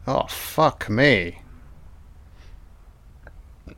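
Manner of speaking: ordinary delivery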